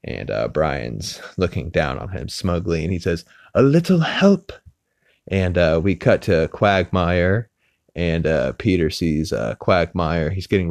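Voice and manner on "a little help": higher, louder cartoon baby voice; a high little plea from under the chair; voicing Stewie. Stewie asks Brian for help in a high put-on voice.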